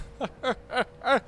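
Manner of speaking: high, broken-up laugh; amused cackle up high. Real, not acted. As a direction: laugh it off after being fooled. Laughing at being faked out.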